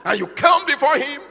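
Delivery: high, loud pulpit warning; rising preached rebuke. Acted. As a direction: build to a pulpit cry.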